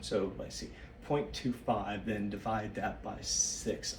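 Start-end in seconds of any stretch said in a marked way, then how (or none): none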